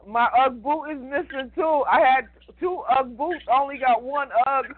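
mock whiny complaint. Teasing complaint about missing boot.

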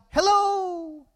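high, drawn-out call into the woods; high-pitched holler; boy yelling out. Retold or imitated. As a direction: call out hello, pitched well up. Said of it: "he yelled out".

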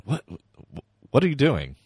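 sudden loud high cry of alarm; startled shout. Retold or imitated. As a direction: yell it out, caught off guard. Startled by sudden dragon scream.

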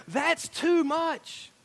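slow, high, drawn-out groan of defeat; high slow mock whine of someone giving up; defeated complaint. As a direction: give up with a slow whiny moan. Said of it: "They go".

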